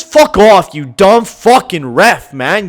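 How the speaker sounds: loud, slow furious insult; angry yell. Real, not acted. Gamer cursing the referee after a penalty, loud and angry. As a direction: spit it at the ref.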